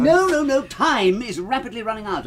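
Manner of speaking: sharp, high, loud no's; urgent alarm. Acted. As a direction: stop them in a sudden panic. Urgent, must warn others now.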